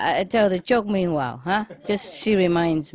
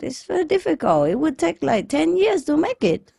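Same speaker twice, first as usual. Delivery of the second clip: high-pitched balking at a huge job; daunted protest; 8 semitones higher than usual. Retold or imitated. Genie looking at the wide river, high-pitched daunted protest. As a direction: protest that it's too much, voice lifted high. Genie balks at bridge wish.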